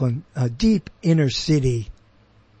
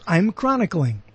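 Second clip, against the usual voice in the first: ordinary delivery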